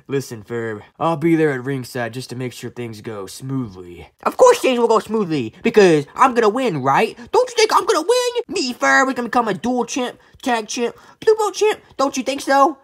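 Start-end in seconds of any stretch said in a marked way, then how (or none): 0.1-4.1 low character voice. Pretend. Low figure voice. 7.3-12.8 high cartoon voice. High eager figure.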